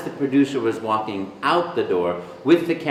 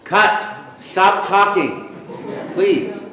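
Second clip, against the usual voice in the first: loud, higher, drawn-out plea; exasperated call to stop; 6 semitones higher, 4 dB louder, much slower than usual. Retold or imitated. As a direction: call a halt to someone who won't stop. Producer halts endless audition, voiced.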